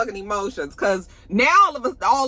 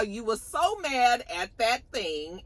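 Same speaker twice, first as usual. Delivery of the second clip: ordinary talk, nothing marked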